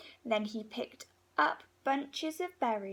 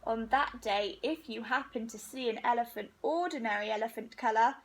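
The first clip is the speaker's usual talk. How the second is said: usual speaking voice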